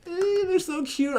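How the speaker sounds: high, slow squeal of delight; gushing. Real, not acted. Fan squealing at a sweet moment, high and slow. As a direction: coo it like a fan melting over a couple. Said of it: "And I'm like".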